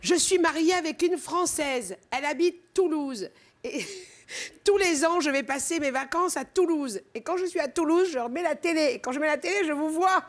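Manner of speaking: high-pitched imitation of a gushing fan; thrilled taxi driver. Guest playing a cab driver who recognised her, high and thrilled. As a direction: play a starstruck driver, high and emphatic.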